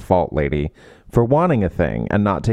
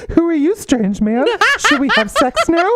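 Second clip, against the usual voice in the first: mock swooning heroine. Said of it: "which is like".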